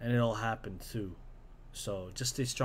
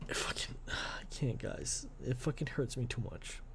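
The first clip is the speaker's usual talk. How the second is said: choked, whispered grief; broken whisper; 8 dB quieter than usual. Real, not acted. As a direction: barely get the words out, fighting tears. Grieving, voice breaking off.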